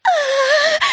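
long, very high scream; sustained shriek of agony. A narrator's reading. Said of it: "заорала Энн". (протяжный пронзительный крик)

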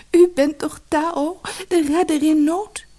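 very high, tearful-sounding plea; plaintive wail. A narrator's reading. Lotusbloem pleads with her rescuer, wailing plaintively. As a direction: wail it high, as a weeping girl. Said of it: "klaagde ze".

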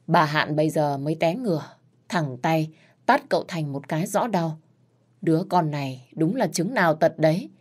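usual speaking voice

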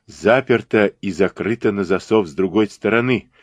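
plain reading voice

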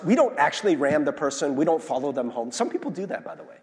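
usual speaking voice

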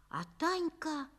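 usual speaking voice

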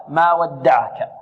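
ما ودعك slow with every letter thin; light thin letters, slow. Shown as a demonstration. Teacher modelling ما ودعك for the class. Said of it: "كلها مرققة".

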